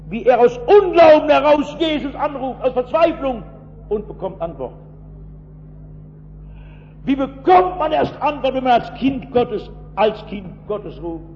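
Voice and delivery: impassioned preaching. Acted. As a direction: build it up and call it out to the hall.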